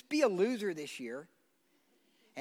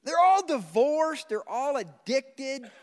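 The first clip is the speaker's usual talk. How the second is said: loud, slow, higher punchline; full-force preaching; 5 semitones higher, 8 dB louder, slower than usual. Acted. Preacher exposing stars' lives, full-force preaching. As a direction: land each word with weight.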